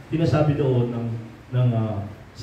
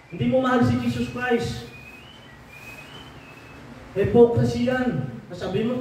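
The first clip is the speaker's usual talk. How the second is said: a pastor's rising sermon rebuke; fiery preaching; 7 semitones higher than usual. Acted. Pastor scolding congregation over broken promises to God, fiery preaching. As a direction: preach it high and forceful, rebuking the flock.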